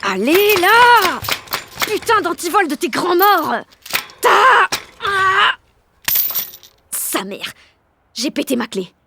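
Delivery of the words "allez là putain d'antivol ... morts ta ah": loud effortful grunting shout; straining and swearing. Acted. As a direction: fight the lock and curse out loud. Straining at a stuck lock.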